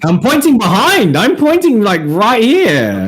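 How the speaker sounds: high, emphatic protest; exasperated insistence. Real, not acted. Player correcting the game master on where he points, exasperated. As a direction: insist loudly, swooping up high and stretching the last words.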